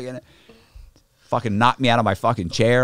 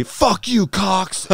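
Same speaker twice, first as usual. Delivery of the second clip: high, louder mock-angry yell; angry retort; 11 semitones higher, 4 dB louder, much slower than usual. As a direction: imitate a riled inmate lashing out. Taunted inmate curses back.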